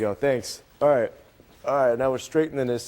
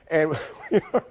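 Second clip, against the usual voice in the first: anchor stifling giggles; faster than usual. Real, not acted. Weatherman giggling on air.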